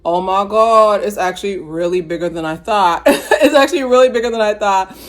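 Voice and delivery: loud, high comic freak-out breaking into a laugh; mock shock. Reviewer voicing heroes meeting the monster dragon, mock shock. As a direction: exclaim in hammed-up disbelief.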